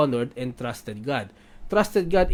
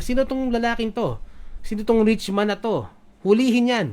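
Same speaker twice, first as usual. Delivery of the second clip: angry king; 7 semitones higher than usual. Retold or imitated. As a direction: play David furious at the rich man. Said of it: "galit na galit si David".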